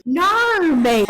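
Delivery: high, drawn-out Australian "naur, mate"; mock Australian accent. American showing her friend how she sounds, mock Australian accent. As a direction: parody an Aussie protest. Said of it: "it's exactly what you sound like".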